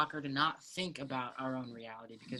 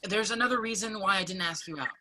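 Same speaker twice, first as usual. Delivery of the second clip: raised voice; 5 semitones higher, 7 dB louder than usual. Acted. Zach confesses, emotional.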